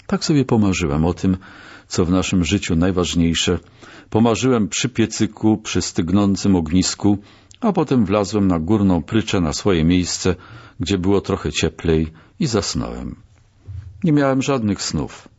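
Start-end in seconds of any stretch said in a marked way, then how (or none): none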